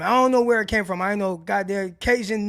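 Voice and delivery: ordinary delivery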